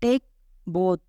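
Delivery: "Take ... both", clear pause between the words; one word at a time; split teaching repeat. Shown as a demonstration. Teacher repeating an English phrase for learners, word by word.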